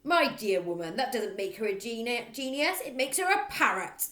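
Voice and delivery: loud sneering bark; scornful bark. Acted. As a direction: bark it mockingly. Trunchbull scoffing.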